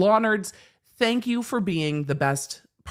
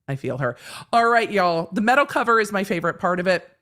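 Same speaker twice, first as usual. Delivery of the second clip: usual speaking voice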